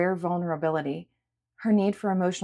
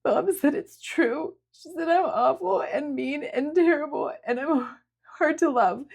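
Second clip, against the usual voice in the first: sobbing; 9 semitones higher than usual. A narrator's reading. Sierra telling Julia what her mother said, sobbing. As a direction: choke the words out through tears. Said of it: "Sierra's shoulders shook again with sobs".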